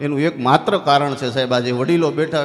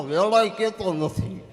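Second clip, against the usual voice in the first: ordinary talk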